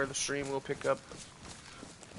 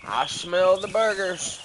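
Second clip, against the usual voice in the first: loud call; 6 semitones higher, 10 dB louder, slower than usual. Pretend. Excited call about food.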